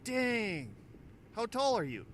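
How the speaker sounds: imitating the stranger. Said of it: "He looks at me and goes".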